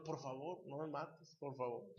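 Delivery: soft, imploring plea for his life; quiet mock pleading. Victim begging the gunman to spare him, quietly imploring. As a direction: beg quietly, as if a gun is at the head.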